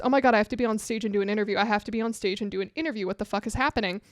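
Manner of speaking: fast, panicked rush; panicked inner voice. Retold or imitated. Retelling her panic on the floor, rushed. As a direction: race through the thought in panic. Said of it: "the only thing that's going through my head is".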